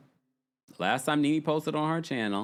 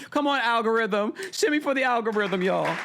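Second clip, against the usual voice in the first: playful cheer; 9 semitones higher than usual. Real, not acted. Cheering on the algorithm.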